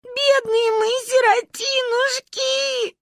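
high, slow wailing lament; keen like orphans. A narrator's reading. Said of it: "сокрушались мы в унисон". (причитание)

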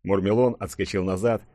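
usual speaking voice

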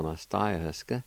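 normal talking voice

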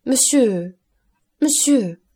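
plaintive interrupting cries; desperate pleading. A narrator's reading. Accused wife begs him to stop.

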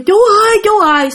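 high, loud cry for help; desperate call to the patrol. Retold or imitated. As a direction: shout to the men far off. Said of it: "kêu".

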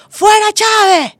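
high, loud, slow jeer; angry neighbour shout. Retold or imitated. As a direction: yell it down the hallway after the blackout. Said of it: "gritó".

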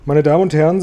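loud, formal opening with fear held back; composed address. A narrator's reading. Grashüpfer to the stranded company, composed address. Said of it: "nicht Angst und Enttäuschung durch den Klang seiner Stimme zu verraten".

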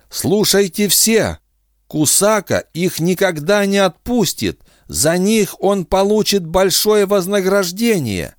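high, otherworldly alien woman. Acted. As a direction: brave alien offering herself. Defin's alien voice held.

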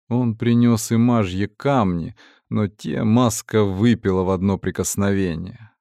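ordinary delivery

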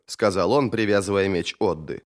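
ordinary delivery

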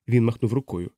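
plain reading voice

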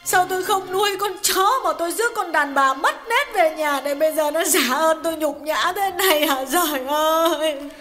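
a hint of sobbing as she bemoans her fate; wife's lightly sobbing complaint; sobbing lament. A narrator's reading. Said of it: "Hà ngồi xuống nức nở tiếp".

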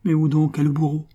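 high, distraught question; frantic. A narrator's reading. Concepcion searching for the executioner, distraught. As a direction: ask it as if losing all composure. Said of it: "demanda-t-elle éperdue".

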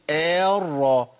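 drawn-out opening vowel bent toward "e" in الرا; imala. Shown as a demonstration. Teacher showing a reading mistake, imala on الرا. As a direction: show الرا with its "a" tilted to "eh". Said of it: "طب خطأ تاني، إمالة".

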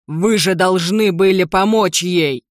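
loud, slow furious reproach; angry accusation. Acted. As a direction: blame them forcefully. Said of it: "зашипел на меня Джим Лендри". (гневный упрёк)

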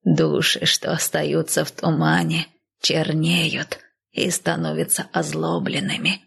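slow, aged nanny, sombre warning; old nanny. Acted. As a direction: speak slowly as a weary old woman. Narrator voices old nanny.

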